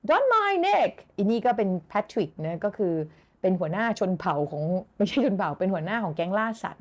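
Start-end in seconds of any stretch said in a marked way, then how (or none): 0.1-0.9 high, raised-voice call; acted shout, pitched way up. Acting out the shouting head.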